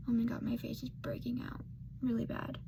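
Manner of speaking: fast whisper to herself; quick whispered aside. Real, not acted. Vlogger complains about her skin to herself, fast whisper. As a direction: rush it out under her breath.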